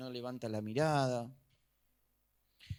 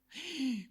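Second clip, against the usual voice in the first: sharp gasp; 10 semitones higher than usual. Acted. Believer gasps at the prophecy.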